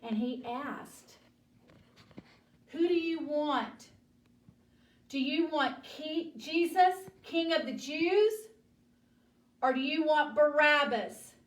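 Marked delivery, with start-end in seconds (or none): 2.7-11.3 raised, louder questioning voice; calling to a crowd. Acted. Pilate asks the crowd whom to free, calling out. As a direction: put the choice to a crowd, higher and louder.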